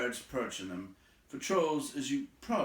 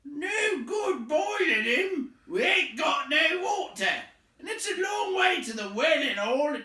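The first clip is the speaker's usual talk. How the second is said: loud, high, slow troll shout; 10 dB louder than usual. A narrator's reading. Troll shouting that there's no water. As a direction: bellow the complaint. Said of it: "said a voice".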